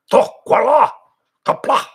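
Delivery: speaking Klingon. Shown as a demonstration. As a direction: belt out the words in Klingon. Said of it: "Speak Klingon".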